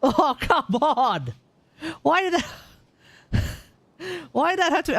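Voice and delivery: half-laughing "why did that" protest; laughing disbelief. Real, not acted. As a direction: laugh through the frustration at a bad roll. Bad artifact roll, frustration.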